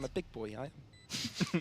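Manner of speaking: lightly voiced Phoenix, soft and low; a hint of Phoenix's voice; Phoenix impression. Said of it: "worst Phoenix impression".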